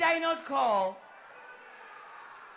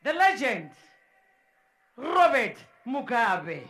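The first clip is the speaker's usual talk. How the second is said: ordinary talk, nothing marked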